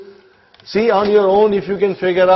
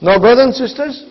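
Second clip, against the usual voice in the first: usual speaking voice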